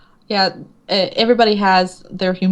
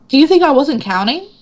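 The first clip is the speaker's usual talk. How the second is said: exasperated retort; 6 semitones higher than usual. Retold or imitated. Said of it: "I'm like".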